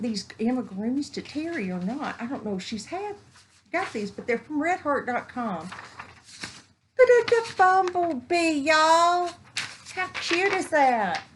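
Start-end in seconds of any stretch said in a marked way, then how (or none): 5.7-11.2 high, loud and slow; gushing at a bumblebee pattern; squealing delight. Real, not acted. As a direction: coo over something adorable.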